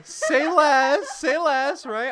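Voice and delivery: loud, high and drawn out; excited. Real, not acted. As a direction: stretch it out with glee. Eager reply to nail offer.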